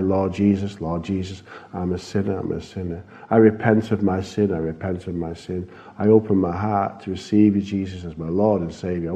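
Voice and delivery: low, hushed sinner's prayer; soft prayer. Retold or imitated. Pastor leads an old man in prayer, soft and low. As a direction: lead him gently through the prayer.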